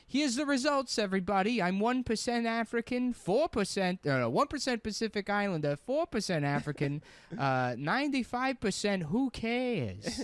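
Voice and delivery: high-pitched mocking impression; Cockfield. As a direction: read out results in a high caricature. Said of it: "So he's like".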